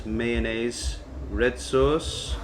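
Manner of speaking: ordinary delivery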